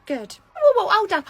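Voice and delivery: ordinary talk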